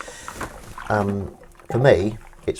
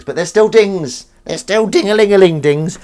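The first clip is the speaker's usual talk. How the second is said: mock triumph; 11 semitones higher, 10 dB louder than usual. Host awarding pity dings, loud mock triumph. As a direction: trumpet the consolation prize.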